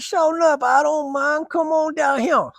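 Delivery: high, slow Cajun accent put on. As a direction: do the old man's Cajun accent, high and drawn out.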